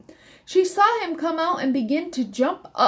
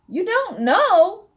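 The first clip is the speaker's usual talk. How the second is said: high, drawn-out question rising at the end; incredulous echo; 8 semitones higher, 4 dB louder, slower than usual. A narrator's reading. Miss Sparrow echoes the clueless elf, high slow disbelief. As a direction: stretch it slowly upward in amused disbelief. Sparrow amused by elf's answer.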